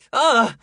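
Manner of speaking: short, high, loud yelp; startled cry. Acted. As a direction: cry out at a sudden bump. Girl bumped into by Leo. (grido di sorpresa)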